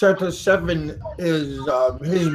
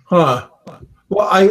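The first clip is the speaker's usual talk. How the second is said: ordinary talk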